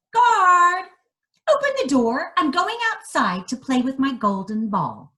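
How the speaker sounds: bossy princess. Acted. Princess commands guard.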